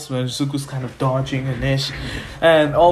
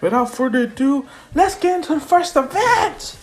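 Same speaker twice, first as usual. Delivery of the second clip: excited MC kicking off the games; event announcer; 7 semitones higher than usual. Acted. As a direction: announce it to the stadium, rising to a high call. Host announces the first event.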